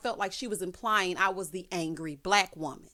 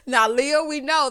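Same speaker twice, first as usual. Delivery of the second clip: laughing; 5 semitones higher, 8 dB louder, slower than usual. Real, not acted. Laughing reply to Leah.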